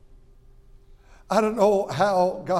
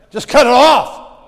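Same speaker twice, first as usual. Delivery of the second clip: raised voice; emphatic loud punchline; 6 semitones higher, 15 dB louder than usual. Real, not acted. Preacher relishing Peter lopping the ear, loud. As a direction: punch the story's climax. Retelling Peter's bold act.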